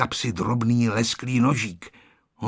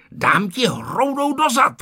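angry yell; 12 semitones higher than usual. A narrator's reading. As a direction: yell it, hunting for a clod. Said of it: "křičel Ferda". (zlostně křičet)

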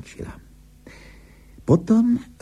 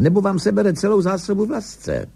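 high, urgent warning; 11 semitones higher than usual. A narrator's reading. Skipper warning angler as fish runs, urgent. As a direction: warn the angler, pitch jumping up.

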